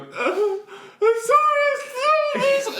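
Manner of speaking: put-on high, slow, wailing child's sob; mock weeping. Kid apologizing to cashier, hammed-up high slow sobbing. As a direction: ham up a tearful little kid's apology. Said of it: "I was absolutely weeping".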